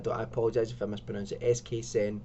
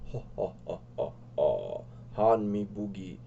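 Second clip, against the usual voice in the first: Jabba the Hutt; 3 semitones lower than usual. Acted. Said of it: "Jabba the Hutt".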